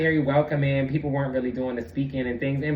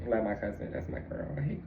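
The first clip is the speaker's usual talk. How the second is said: ordinary talk, nothing marked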